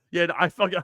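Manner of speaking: ordinary talk, nothing marked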